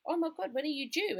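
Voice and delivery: high-pitched astonished question, imitated; surprised teacher. Nursery teacher turning round to a pregnant mum, mock surprise. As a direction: play a teacher taken aback, voice jumping up. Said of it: "one of the teachers turned around and goes".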